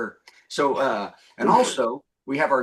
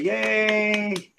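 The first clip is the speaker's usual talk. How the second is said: high, drawn-out yay; cheer; 6 semitones higher than usual. Real, not acted. Host welcoming comedian Stephanie Hodge to the show, cheering. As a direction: lead the room in a long, raised cheer.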